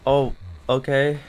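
ordinary talk, nothing marked

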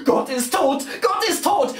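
high cry, climbing on the repeat; shouted proclamation. A narrator's reading. Rider crying out to the stampede, shouted proclamation. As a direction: proclaim it to the whole horde. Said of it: "Und da schreit er".

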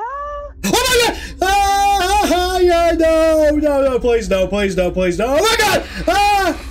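high panicked shrieks and pleas; terrified screaming. Real, not acted. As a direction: scream in real terror. Caught by the entity, screaming.

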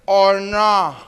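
put-on lightly slurred drunken tag question; mock-drunk "¿O no?"; drunk grandfather. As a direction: hang on the words like a stuck drunk. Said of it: "Ya bien pedo, ya es que te trabas".

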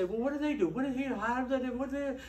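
mock questioner. A skeptic asking what church members do, mock puzzled. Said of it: "someone would say".

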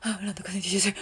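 mock mumble, words run together under the breath; mimicked unintelligible mumble. Teacher mimicking the drama heroine too furious to be heard, mumbled. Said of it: "막 이렇게 말해서 들리지가 않았어요".

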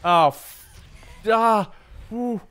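high, loud startled cries; startled yelps. Real, not acted. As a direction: jump and yelp. Jump scare.

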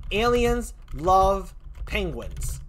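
aliens love penguins, each word drawn out slowly; slow emphatic slogan. Shown as a demonstration. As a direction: deliver the takeaway line slowly, word by word. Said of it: "just one word I had to take away".

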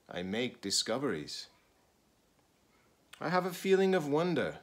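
expressive picture-book read-aloud; reading to children. Acted. Teacher reading a picture book to students, expressive read-aloud.

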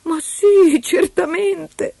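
very high, drawn-out eager agreement; assenso accorato. Acted. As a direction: agree eagerly, stretching the last word. Eloisa accetta subito, sollevata. (assenso accorato, voce acutissima)

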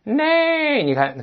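usual speaking voice

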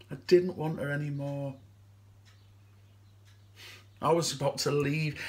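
low, soft, haunted admission; subdued confession. Acted. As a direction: confess it quietly, low and halting. Confesses about her suicide note.

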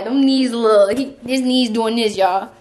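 normal talking voice

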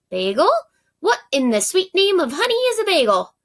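bagel jumps up high in disbelief; a baffled beekeeper who has never heard the word; puzzled beekeeper. Acted. Beekeeper asks Yum Young what a bagel is, high baffled voice.

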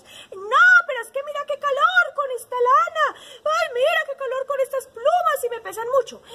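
high, loud whiny protest; grumpy animals. A narrator's reading. Sheep and hen grumbling back at the parrot, angry. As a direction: protest like hot, fed-up storybook animals. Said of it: "Y ellos eran enojados".

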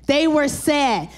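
normal talking voice

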